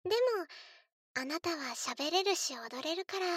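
usual speaking voice

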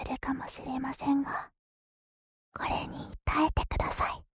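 breathy whisper into the ear. Acted. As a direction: whisper it playfully, close up. ASMR whisper.

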